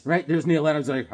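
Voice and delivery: ordinary delivery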